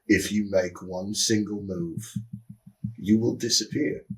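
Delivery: slow, softly spoken warning; quiet threat. Acted. To the man just tackled, quiet threat.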